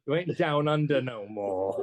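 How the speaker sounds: high, slow put-on Aussie twang; joking Australian impression; mock Australian accent. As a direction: play at an Australian accent, drawn out. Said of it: "that was a good accent".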